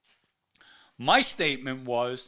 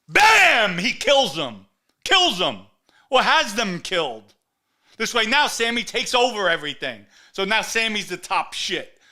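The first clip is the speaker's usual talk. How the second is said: furious shout; 4 semitones higher, 8 dB louder than usual. Real, not acted. Host raging to listeners about Sammy killing friends, furious shouting. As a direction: explode on Bam, then keep hammering loud.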